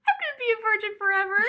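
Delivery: high, rushed tearful wail; exaggerated high-pitched weeping; mock crying. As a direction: imitate a drugged, weepy teen through laughter. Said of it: "I was hysterically crying".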